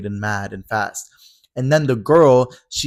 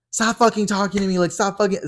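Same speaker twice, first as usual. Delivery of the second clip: put-on drunk snap; 9 semitones higher than usual. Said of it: "Drew was like".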